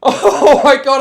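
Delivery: real laughing outburst. Real, not acted. Streamer laughing at the bleakness.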